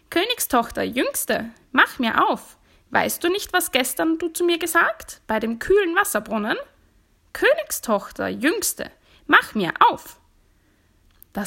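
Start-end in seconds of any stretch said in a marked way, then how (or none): 0.1-10.2 high, loud, swooping frog call. A narrator's reading. Frog calling a second time to the princess, insistent call. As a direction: call through the door, pitch leaping up. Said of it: "und der Frosch rief".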